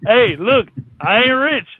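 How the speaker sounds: normal talking voice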